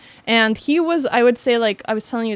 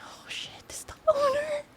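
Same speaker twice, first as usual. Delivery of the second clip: breathless whispered panic, much higher; shocked whisper; 8 dB quieter than usual. Retold or imitated. Cook whispers in shock on realizing the chef is the owner. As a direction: gasp it out under your breath.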